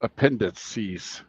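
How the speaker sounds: "Appendices" drawn out slowly in syllables; demonstrated pronunciation. Shown as a demonstration. Co-host answers how it's pronounced, "Appendices" slowly sounded out. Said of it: "And it's pronounced how?".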